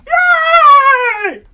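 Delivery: a high, loud cheer of joy; joyous whoop. Acted. George rejoicing at being alive again, joyous whoop. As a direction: whoop like you just got your life back.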